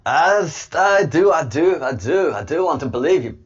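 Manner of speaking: high, loud mock-Scottish plea, sliding into Cockney; bad Scottish accent. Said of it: "that was Cockney. That was, that was bad".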